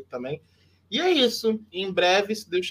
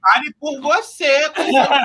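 loud, high laughing comeback; laughing retort; 9 semitones higher, 10 dB louder than usual. Real, not acted. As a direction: fire it back through laughter. Mock-offended retort, laughing.

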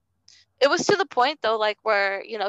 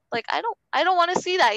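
reliving the fear; 8 semitones higher, 4 dB louder, faster than usual. Retold or imitated. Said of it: "I'm, like, scared".

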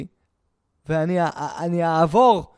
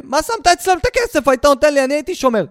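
higher, louder, angry demand to his father; retold anger; 5 semitones higher, 5 dB louder than usual. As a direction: complain hotly to dad. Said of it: "באתי לאבא שלי בעצבים". (בן כועס על אבא שלו בגלל הכסף, בקול גבוה ונרגז)

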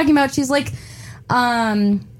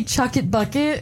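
quoting British slang; 5 dB quieter than usual. Retold or imitated. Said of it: "'Cause they actually say".

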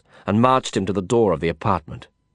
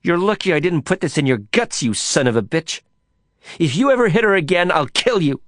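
higher, louder furious threat with a knife; threatening a man who hit her friend, snarling rage; 9 semitones higher, 4 dB louder than usual. Acted. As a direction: snarl it at him, building to a shout. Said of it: "Lisa snarled at him".

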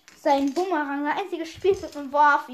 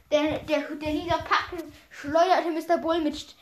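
ordinary delivery

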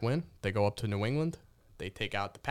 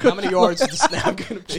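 laughing through a higher louder question; 8 semitones higher, 8 dB louder than usual. Real, not acted. Laughing at the wife's silly bets. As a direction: crack up while asking.

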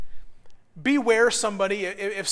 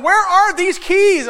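frantic frustration; 8 semitones higher, 7 dB louder, slower than usual. Retold or imitated. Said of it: "about to tear my hair out going".

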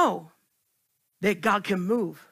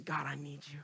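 hushed plea; 8 semitones lower, 16 dB quieter, faster than usual. Pretend. Acted quiet plea to God.

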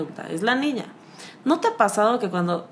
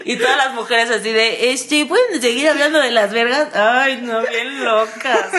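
mocking laugh; 3 semitones higher, 6 dB louder, slower than usual. Mimicking the women in the circle, laughing loudly. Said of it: "mocking the women".

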